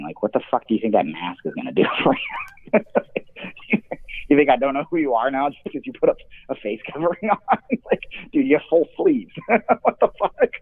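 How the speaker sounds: incredulous, breaking into laughter; scoffing between bursts of laughter; laughing derision. Real, not acted. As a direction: laugh through the words at a useless disguise. Laughs mocking the biker's mask.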